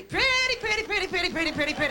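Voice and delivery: mock the pressure to be pretty; lightly mocking chant; mocking sing-song.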